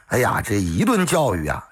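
ordinary delivery

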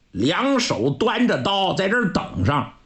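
usual speaking voice

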